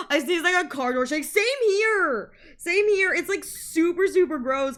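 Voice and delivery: high, loud laughing agreement; laughing excitement. Real, not acted. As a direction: burst in, laughing along.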